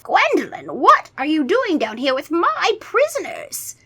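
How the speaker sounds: angry vampire count. Acted. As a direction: demand like an angry master. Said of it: "Count Nightwing demanded angrily".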